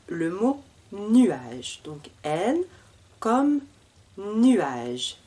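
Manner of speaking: nuage stretched out slowly; slow, careful model of nuage; slow clear model word. Shown as a demonstration. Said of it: "pour bien entendre".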